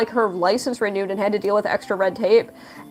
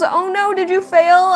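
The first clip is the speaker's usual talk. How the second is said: high, loud concerned question; imitated worried boyfriend; 10 semitones higher, 5 dB louder, slower than usual. Boyfriend finding her crying after the test, imitated worried alarm. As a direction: ask in pitched-up mock alarm. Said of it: "and he goes".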